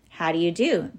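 usual speaking voice

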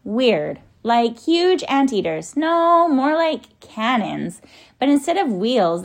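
high, loud searching for words; excited report. A narrator's reading. Tom describes the creatures from the tree, excited calling. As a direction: call it out high, drawing out key words.

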